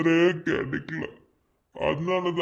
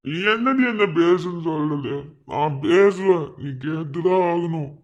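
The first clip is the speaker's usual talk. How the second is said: louder, bitter half-laughing retort; angry insistence; 5 dB louder than usual. Acted. Man on the phone insists she must listen, loud and bitter. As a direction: force the words at her.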